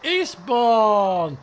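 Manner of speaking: ordinary talk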